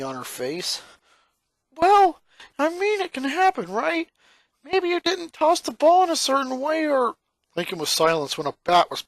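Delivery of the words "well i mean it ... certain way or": much higher, louder, anxious rush of words; nervous backpedal. Acted. Lincoln faces a glaring Lynn, high nervous backpedaling. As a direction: squeak it out, scrambling to justify.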